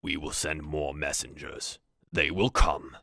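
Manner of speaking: measured, deepened orc voice; assured orc chief giving his word; orc leader. Acted. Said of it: "Valrul nods and goes".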